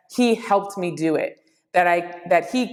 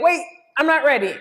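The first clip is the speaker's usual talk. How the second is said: quick, high-pitched stalling; mock panic; 7 semitones higher, faster than usual. To the child counting her in, mildly panicked. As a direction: blurt it as if halting a countdown.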